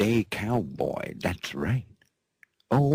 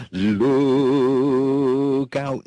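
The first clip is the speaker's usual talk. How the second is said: hammed-up warning call; 6 semitones higher, 6 dB louder than usual. Radio host hamming a warning.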